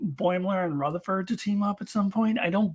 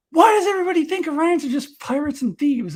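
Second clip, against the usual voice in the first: indignant Tendi; 11 semitones higher, 6 dB louder than usual. Retold or imitated. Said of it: "is like".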